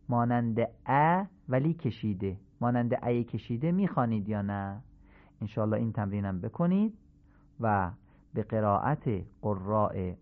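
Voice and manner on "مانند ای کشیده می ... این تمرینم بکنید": ordinary delivery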